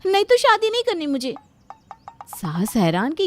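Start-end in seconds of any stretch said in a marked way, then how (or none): 0.1-1.3 high and loud, a sudden outburst; loud, stubborn bride's threat; raised threat. Acted. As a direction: blurt it out like a spoilt ultimatum. Bride threatens to break engagement.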